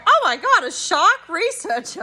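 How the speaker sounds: high, loud burst of delight on Oh my God, then settling; enthusiastic repeat. Retold or imitated. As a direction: echo her dream back with real excitement. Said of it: "repeated it with a bit of enthusiasm".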